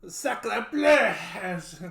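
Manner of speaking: high, loud cry of pain in French; loud French yell. Acted. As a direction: yell it out as the stinger hits. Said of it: "lets out a loud French yell".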